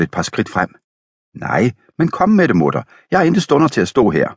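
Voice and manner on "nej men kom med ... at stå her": higher, fast and impatient; impatient urging. Acted. Husband urges wife to hurry. (utålmodig, højere og hurtig)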